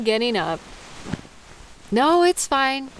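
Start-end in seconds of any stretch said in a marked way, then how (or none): 1.9-2.9 firm and louder, insisting it's fine; insistent. Real, not acted. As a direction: brush off the concern firmly. Insisting after the listener offers.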